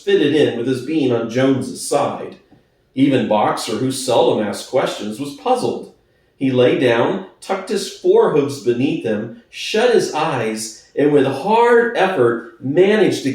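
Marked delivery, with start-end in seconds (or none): none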